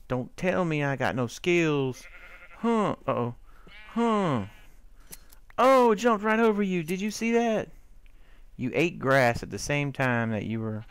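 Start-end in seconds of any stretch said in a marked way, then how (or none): 2.6-7.7 high, loud excited yelps; squealing at the game. Real, not acted. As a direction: react with real surprise. Excited at sheep jumping.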